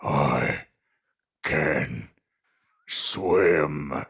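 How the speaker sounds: very low, slow, one word at a time; menacing pause between each word; deep growling bear. Acted. Polar Papa to the sun bears, low and slow. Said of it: "panting angrily, says".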